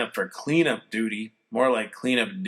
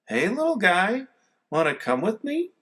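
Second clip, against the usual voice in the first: higher, sweet voice for a puppy; boy coaxing a runaway puppy; pet voice; 8 semitones higher than usual. A narrator's reading. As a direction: coax the dog gently. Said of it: "nicest high-pitched pet voice".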